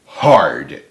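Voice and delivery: ordinary delivery, nothing marked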